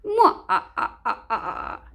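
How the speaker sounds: mwah-ah-ah villain snigger; wicked pantomime laugh; fiendish snigger. Acted. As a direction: cackle like a cartoon villain. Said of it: "they let out a fiendish snigger".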